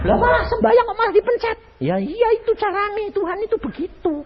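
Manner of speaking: high, fast incredulous protest; indignant surprise. Retold or imitated. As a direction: sputter in disbelief. Dialogue with God voiced.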